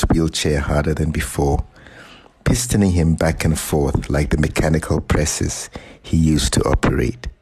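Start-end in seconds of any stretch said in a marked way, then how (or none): none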